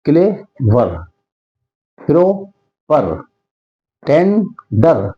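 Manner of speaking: "Clay... वर", "pro... पर", "टेन... डर", with clear gaps; slow teacher's split of "टेन डर" and the rest; syllable by syllable. Shown as a demonstration. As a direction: pause between the halves of "clay वर", "pro पर", "टेन डर". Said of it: "दो बार वो बोले हैं".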